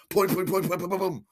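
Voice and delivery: high, rapid-fire boings; bouncy string of popping sounds; popping buttons. Retold or imitated. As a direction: imitate buttons pinging away.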